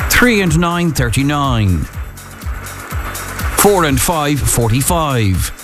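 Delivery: slow bingo calling, each number announced; bingo caller. Acted.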